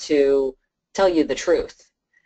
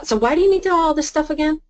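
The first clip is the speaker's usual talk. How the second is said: high, fast mock client voice, louder; suspicious client; 14 semitones higher, 4 dB louder, faster than usual. Advisor mimics a wary client who questions him, high and fast. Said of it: "they say".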